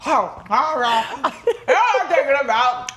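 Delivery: hammed-up eating-while-talking voice, laughing; imitated mouthful talk. Host mimicking herself eating on the podcast, mouth stuffed. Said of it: "just, like, mouthful".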